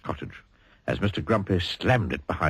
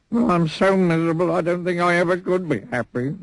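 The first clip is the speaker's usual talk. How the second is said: miserably; 10 semitones higher than usual. Acted. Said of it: "he added miserably".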